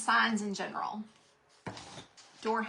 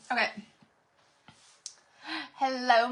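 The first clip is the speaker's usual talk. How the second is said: ordinary delivery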